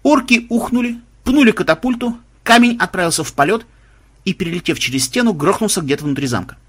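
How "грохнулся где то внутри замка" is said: ordinary delivery, nothing marked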